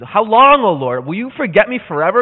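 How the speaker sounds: loud, high, furious outcry; angry. Shown as a demonstration. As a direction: demand an answer from God in anger. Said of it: "He's angry".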